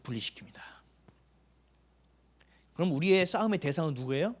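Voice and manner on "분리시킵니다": whispered key word; a whispered punchline. Real, not acted. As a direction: let the word fall to a breathy hush. Preacher drops voice on key word.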